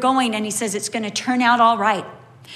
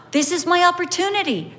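higher and quick, eager; eager realisation; 6 semitones higher, faster than usual. Acted. Preacher voicing a believer's eager thought, higher and fast. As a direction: voice a sudden hopeful idea.

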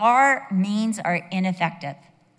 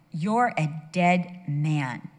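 very slow, weighted pronouncement; God's stern warning; 4 semitones lower, much slower than usual. Retold or imitated. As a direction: deliver a slow death sentence as God. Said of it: "It works when it comes from God".

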